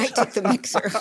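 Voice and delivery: laughing. Real, not acted. Wife admits she took the mixer, laughing. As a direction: owns up to taking the mixer with a laugh. Teasing confession about taking mixer.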